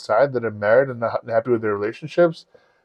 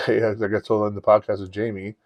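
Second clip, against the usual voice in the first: ordinary delivery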